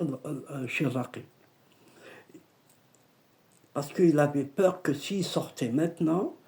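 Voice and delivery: usual speaking voice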